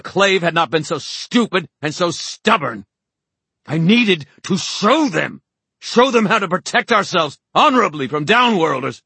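high, loud self-justifying rant; furious, overwrought harangue; enraged tirade. A narrator's reading. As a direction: shout it in high-pitched outrage. Horace's tirade goes on.

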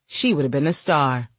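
ordinary delivery

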